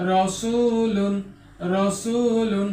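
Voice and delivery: রসুলুন with a thick, full র, drawn out slowly; heavy ra. Shown as a demonstration. Quran teacher shows the heavy র in রসুলুন. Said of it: "এটাকে রাসুলুন বলা যাবে না".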